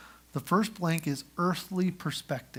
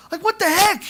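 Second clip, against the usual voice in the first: much higher, louder exclamation; incredulous outburst; 14 semitones higher, 10 dB louder than usual. Real, not acted. Preacher reacting to Joseph's sale into slavery, incredulous. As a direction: throw up your hands in disbelief.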